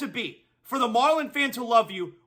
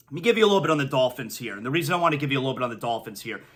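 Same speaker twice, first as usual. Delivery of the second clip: fast mumble; 8 semitones lower, 3 dB quieter, much faster than usual. Real, not acted. Rushing quiet transition.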